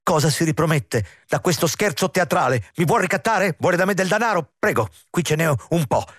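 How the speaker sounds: high, rattled questions; high-pitched nervous protest; agitated outburst. A narrator's reading. Agitated intendant confronts his threatening visitor.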